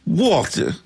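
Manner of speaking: mock New York call of a name; New York accent. Retelling a friend's late-night call, New York accent. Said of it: "in a New York voice".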